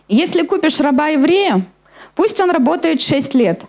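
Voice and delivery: ordinary delivery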